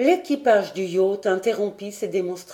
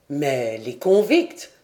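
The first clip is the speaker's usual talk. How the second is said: lightly voiced lord, slow and low; lower, drawn-out question rising at the end; 6 semitones lower, slower than usual. A narrator's reading. Glenarvan asking the sailor about convicts, mildly urgent question.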